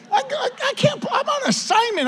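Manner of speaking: frantic, spluttering refusal; stammering, high-pitched panic; panicked protest. Retold or imitated. Refusing to pull over.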